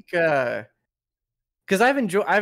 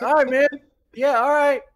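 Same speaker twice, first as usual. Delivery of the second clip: high, louder, worn-out "all right"; exasperated agreement; 11 semitones higher, 5 dB louder than usual. Retold or imitated. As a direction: humor him, fed up but going along. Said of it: "And you're like".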